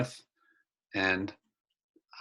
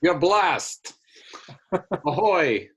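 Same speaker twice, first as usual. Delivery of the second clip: jolly higher-pitched hail; 6 semitones higher, slower than usual. Acted. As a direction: call out a hearty, offhand greeting. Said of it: "sounds really jolly".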